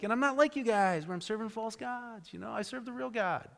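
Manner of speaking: a hint of looking down on the sailors; superior tenor. Jonah tells sailors he serves the real God, mildly smug. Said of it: "there may have been that little tenor".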